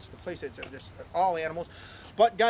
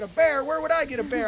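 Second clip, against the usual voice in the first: higher and louder feigned shock; mock disbelief; 8 semitones higher, 6 dB louder than usual. Owner denies having a bear to the driver, in mock shock. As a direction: play innocent, outrageously.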